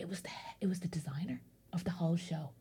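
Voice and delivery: real hushed whisper for the reveal. Real, not acted. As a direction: drop to a whisper for the secret. Revealing the shocking twist.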